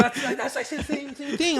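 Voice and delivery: high, loud excited agreement through laughter; high-pitched, amused outburst; laughing agreement. Real, not acted. Laughing agreement at shared experience.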